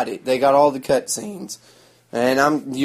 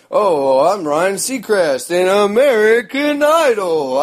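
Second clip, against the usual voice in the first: slow, higher, lightly put-on showy voice; mock him as a vain TV host; mocking Ryan Seacrest; 5 semitones higher, 4 dB louder, slower than usual. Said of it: "talk like a".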